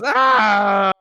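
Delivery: high, loud scream of a man on fire; burning scream. Acted. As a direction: scream as the fire takes him. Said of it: "he just goes".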